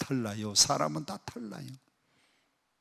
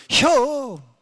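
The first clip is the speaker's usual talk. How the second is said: one-syllable Chungcheong 혀, much higher and louder; Chungcheong dialect; 10 semitones higher, 6 dB louder than usual. Retold or imitated. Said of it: "충청도 사람들은".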